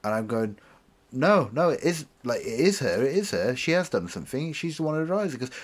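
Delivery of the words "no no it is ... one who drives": higher, louder indignant insisting; frantic acted accusation; retold freak-out. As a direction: protest like a wrongly blamed child. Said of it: "And I start freaking out".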